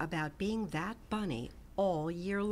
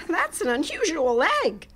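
higher, louder wonder; amazed bunny; 8 semitones higher, 8 dB louder than usual. A narrator's reading. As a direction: marvel at it. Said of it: "Hoppy said".

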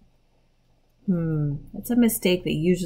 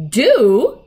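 loud, raised exclamation; incredulous echo; 7 semitones higher, 11 dB louder than usual. A narrator's reading. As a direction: throw the word back, astonished. Peter echoes Susan's question.

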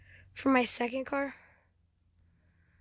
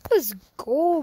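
excited cheer; 5 semitones higher, 9 dB louder than usual. Real, not acted. Celebrating a race win.

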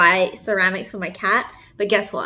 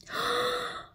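sharp gasp; 12 semitones higher, 12 dB quieter than usual. Real, not acted. Opening the note.

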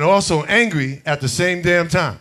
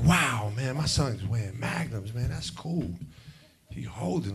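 hushed aside; 6 semitones lower, 11 dB quieter than usual. Retold or imitated. Inner thought, hushed.

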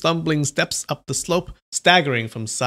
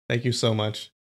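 usual speaking voice

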